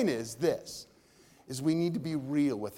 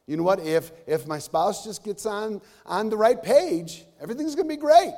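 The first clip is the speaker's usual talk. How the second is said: mock self-assured; 6 semitones higher, 6 dB louder than usual. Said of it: "Because you think you got it figured out".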